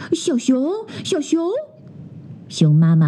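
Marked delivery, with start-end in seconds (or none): 0.1-1.5 a lightly voiced worried call, higher; mother bear calls for the missing cub; anxious calling.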